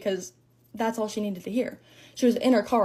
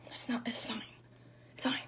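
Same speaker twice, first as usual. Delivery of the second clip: barely voiced mock-tearful whisper; tiny whispered brush-off; hushed reassurance; 16 dB quieter than usual. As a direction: act out faintly insisting all is well. Acted tearful reassurance to herself.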